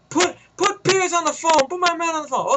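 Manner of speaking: radio henchman. Retold or imitated. Henchman on the radio calling Pace, worried. Villain on phone.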